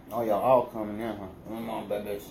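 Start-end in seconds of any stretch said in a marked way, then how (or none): none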